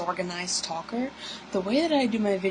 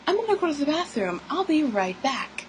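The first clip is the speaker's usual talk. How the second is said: put-on quoted girl, higher and fast; breezy fake-casual excuse, quick and high; 8 semitones higher than usual. Katie quoted leaving to bathroom.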